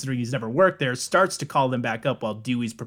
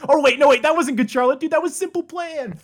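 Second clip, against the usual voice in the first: excited; 13 semitones higher, 5 dB louder than usual. Real, not acted. Realizing a mistake, exclaiming.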